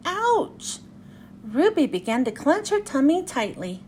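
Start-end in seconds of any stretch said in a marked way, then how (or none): 0.1-0.8 mild pained yelp. Acted. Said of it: "she yelled".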